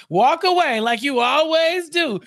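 slow, high acted reproach; accusing taunt. Acts mom taunting Harvey.

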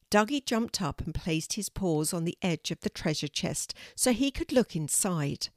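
ordinary delivery

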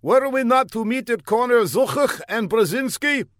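earnest, high put-on foreign voice; an eager young spy calling out; high comic foreigner. A narrator's reading. Fla calls down the block to the older man, high and eager.

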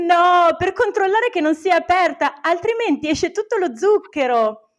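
high, teasing retold punchline; gleeful punchline. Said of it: "e gli dico ridendo".

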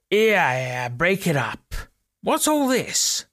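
a London bobby parting a crowd; stern bobby breaking it up; commanding bobby. A narrator's reading.

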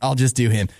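usual speaking voice

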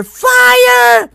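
high, loud and slow shout on fire. Shown as a demonstration. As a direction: belt out the word fire. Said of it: "Go nuts screaming fire".

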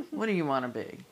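ordinary delivery

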